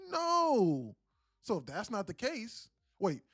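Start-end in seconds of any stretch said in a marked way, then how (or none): 0.1-1.0 high, sarcastic one-word answer. Pretend. Streamer mocking a viewer's question with a high sarcastic no. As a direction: scoff the no way up high. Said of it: "in a sarcastic way".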